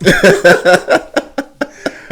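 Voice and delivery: loud, high burst of laughter; big real laugh. Real, not acted. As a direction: crack up at yourself. Laughing at his own story.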